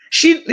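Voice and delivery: real burst of laughter; laughing. Real, not acted. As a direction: breaks into a laugh. Laughs at chat message.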